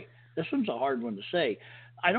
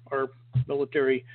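normal talking voice

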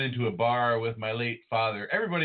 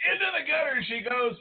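laughing; 12 semitones higher, faster than usual. Real, not acted. Teasing cohost laughing.

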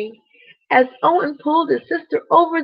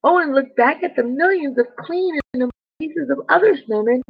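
ordinary delivery

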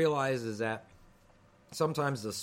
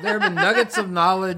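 high, loud laughing retake; 6 semitones higher, 8 dB louder than usual. Real, not acted. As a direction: laugh through the phrase.

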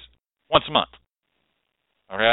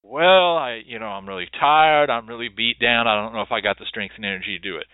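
tired client; 7 semitones lower, 4 dB quieter than usual. Retold or imitated. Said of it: "What do they say?".

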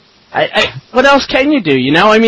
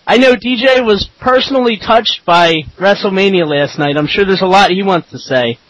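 ordinary delivery

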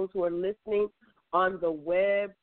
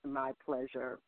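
lower, softer groggy morning voice; imitating morning voice; 4 semitones lower, 8 dB quieter than usual. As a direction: mimic her sleepy low mumble. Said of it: "That's her morning voice".

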